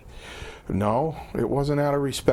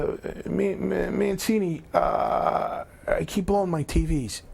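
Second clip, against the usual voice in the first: high, stammering caller impression; Chief; 6 semitones higher, slower than usual. Old boss phones about blown TVs, stammering impression. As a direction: imitate a caller fumbling for the name, pitched up. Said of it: "He said".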